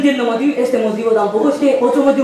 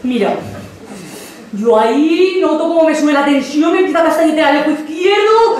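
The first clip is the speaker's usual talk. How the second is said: usual speaking voice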